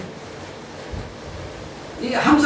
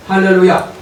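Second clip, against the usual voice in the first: normal talking voice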